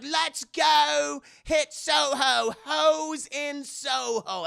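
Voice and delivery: high, slow, loud chant; high loud slow party chant; party shout. Retold or imitated. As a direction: whoop it like girls heading out. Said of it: "everyone's like".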